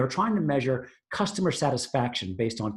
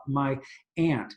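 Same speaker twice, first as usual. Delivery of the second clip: usual speaking voice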